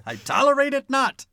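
high, loud mock-regal pronouncement; mock lofty decree. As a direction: proclaim it like a pompous ruler. Mock stern scolding of a friend.

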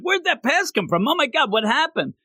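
high-pitched mock amazement; astonished onlookers. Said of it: "they're like".

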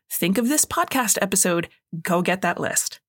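ordinary talk, nothing marked

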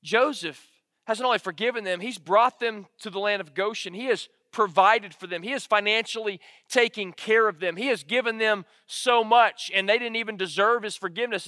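normal talking voice

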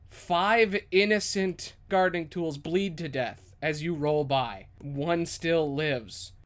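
normal talking voice

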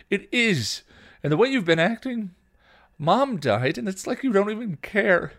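loud, high outburst; heated, shouted reproach; angry accusation. A narrator's reading. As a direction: hurl the accusation at a sister. Younger sister accuses in quarrel.

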